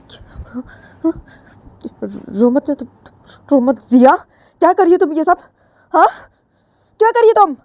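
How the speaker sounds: mother finding Ritu beaten, shocked shouting. Acted. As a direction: burst in horrified at what you see.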